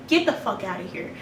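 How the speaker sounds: ordinary delivery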